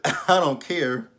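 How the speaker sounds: loud, slow, dismissive quote; retold nastiness. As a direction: imitate him rudely brushing her off. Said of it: "he's just been a complete asshole to her".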